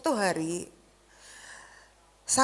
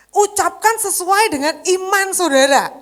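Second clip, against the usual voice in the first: forceful exhortation; 8 semitones higher, 9 dB louder than usual. Acted. Preacher exhorts congregation.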